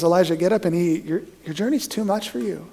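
soft, understated acknowledgement; gentle God. Acted. God to exhausted Elijah, soft and understanding. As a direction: let him off gently, quietly. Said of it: "God says".

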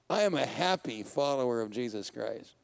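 ordinary delivery

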